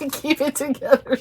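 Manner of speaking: real teary laugh, words squeezed up high; laughing through tears. Real, not acted. Holding it together over the card, laughing through tears. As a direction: laugh and sob at once. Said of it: "trying so hard to keep it together".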